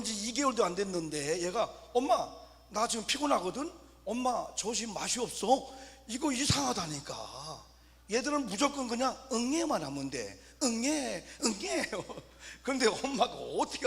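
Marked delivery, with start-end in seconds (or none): 1.9-5.7 put-on higher, louder whiny baby voice; mock baby talk. Two-month-old baby complaining to mom, hammed-up whine. 10.6-11.9 imitated baby cry. Preacher imitating a hungry newborn crying, mock wail.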